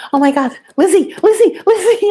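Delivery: imitating a frantic owner shaking a still pet; retold panicked calling. Retelling how she calls her limp lizard, high mock panic.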